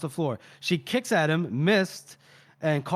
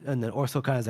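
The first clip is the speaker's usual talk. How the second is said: ordinary delivery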